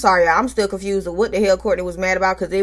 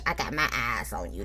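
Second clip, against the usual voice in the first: far lower, far softer menacing murmur; 12 semitones lower, 14 dB quieter than usual. Retold or imitated. Host echoing Stormy's warning, low and hushed. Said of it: "That's what she tell us".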